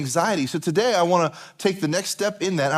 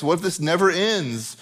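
ordinary delivery

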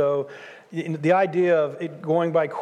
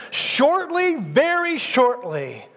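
Shortly leaps very high, then very shortly stretched out slowly; stress on shortly; 6 semitones higher, much slower than usual. Acted. Preacher paraphrasing the author of Hebrews, Shortly leaping high and drawn out. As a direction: hit Shortly high and drag very shortly.

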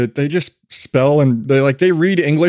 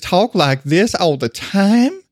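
slow, higher, overdone Texas drawl; cartoonish Texan accent; 4 semitones higher, slower than usual. Mimicking wife's grandmother from Wyoming, overdone Texan accent. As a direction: ham up a cartoon Texan, stretching the vowels. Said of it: "overdone cartoonish Texan accent".

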